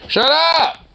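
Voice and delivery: high-pitched scolding outburst; exasperated yell. Real, not acted. Snapping at the barking dog.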